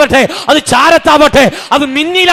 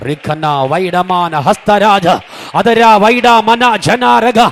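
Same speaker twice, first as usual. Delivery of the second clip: deep prayer in tongues; 6 semitones lower, much slower than usual. Real, not acted. Preacher sinking into prayer before the congregation, low slow tongues. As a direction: drop the voice low and let the syllables drift slowly.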